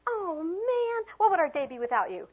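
high-pitched gushing delight; enthusiastic welcome. Shown as a demonstration. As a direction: make the child feel adored. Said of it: "Change that whole thing".

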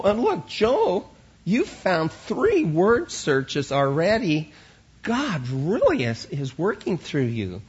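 high, loud, delighted teacher praise; excited praise. Retold or imitated. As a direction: gush over the children's work. Acts teacher praising kids at desks.

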